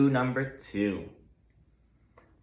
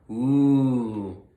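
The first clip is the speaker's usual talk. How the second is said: ooh; 5 dB louder than usual. Acted. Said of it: "said Hampshire, Berkshire, and Yorkie".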